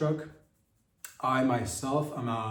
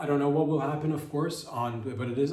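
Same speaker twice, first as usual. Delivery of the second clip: usual speaking voice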